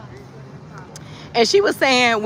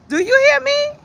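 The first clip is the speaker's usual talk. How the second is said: high, louder rhetorical challenge to the viewers; emphatic call-out; 4 dB louder than usual. Real, not acted. Preaching to her live viewers, high emphatic call. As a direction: demand they're listening, pitched way up.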